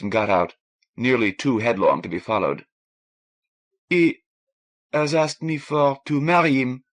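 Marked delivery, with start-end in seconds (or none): 3.9-6.8 put-on French-accented woman blurting. A narrator's reading.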